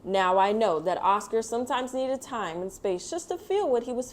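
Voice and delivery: put-on gentle storybook narration read to kids; reading to children. A narrator's reading. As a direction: tell it like a bedtime picture book. Reads picture book aloud.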